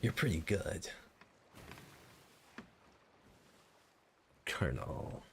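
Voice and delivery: Naked Snake voice. Shown as a demonstration. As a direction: growl it like a grizzled soldier. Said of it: "I do the Naked Snake voice pretty frequently".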